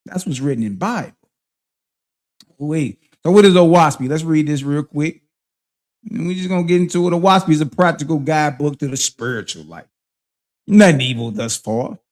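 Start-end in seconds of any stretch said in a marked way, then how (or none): none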